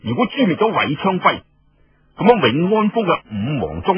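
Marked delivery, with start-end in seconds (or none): none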